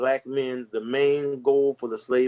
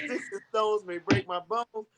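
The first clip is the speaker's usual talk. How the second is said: high, slow, playful mock chant; slow high-pitched send-up of a proverb; mock recital; 9 semitones higher than usual. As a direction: recite the saying mockingly, drawn out. Mocking the saying after laughing.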